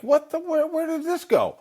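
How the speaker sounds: high, disbelieving cry at the table; shocked question. Retold or imitated. Son shocked by the twelve Easter dishes, high disbelief. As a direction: voice jumps up in mock shock.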